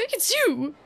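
startled gasp and accusation; surprised gasp. Acted. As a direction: gasp as you spot the enemy. Recognises the rival suddenly.

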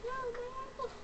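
high, thin cartoonish whine; squeaky high voice. Friend's avatar steals apple.

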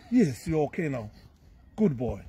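soft, low reassurance; soothing praise. Real, not acted. Calming a boy after washing out sand, soothing.

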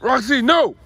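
loud, high "no"; sharp scold. Real, not acted. Owner scolding Roxy, loud sharp no. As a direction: stop the dog with a shout.

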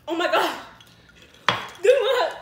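shocked. Real, not acted. Shock at chili heat.

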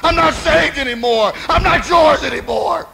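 high, anguished shouted confession; preacher acting out a broken man's wail; bitter weeping cry. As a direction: cry out to God in short, desperate bursts. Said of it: "he wept bitterly".

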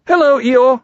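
loud, high called-out greeting; bright storybook hail; cheerful. A narrator's reading. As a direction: sing out a hello to a friend. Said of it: "they called out cheerfully".

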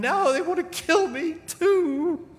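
high, slow, wailing complaint; hammed-up tearful whine; near tears. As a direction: whine it out like he's about to cry. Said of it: "he's about crying".

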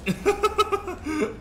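high burst of giggles; delighted laughter. Real, not acted. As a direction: laugh with glee at the stunt. Thrilled by bouncing stunt.

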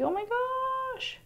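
high, slow gasp of shock; drawn-out startled cry; surprised gasp. Real, not acted. Clip slips, startled exclamation.

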